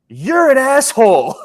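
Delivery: hammed-up shouted insult from the customer; mock angry yell. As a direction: hurl it like a furious shopper. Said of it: "then she goes".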